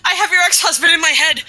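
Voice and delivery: high, loud sudden confession; panicked blurt. Acted.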